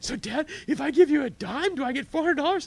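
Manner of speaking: put-on high, loud, hopeful child's question; little son. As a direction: play the eager little boy asking dad. Father voices his little son.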